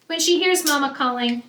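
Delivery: usual speaking voice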